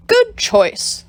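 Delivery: high fawning "Good choice"; butter up the customer; smarmy compliment. Acted. Bob flatters customer.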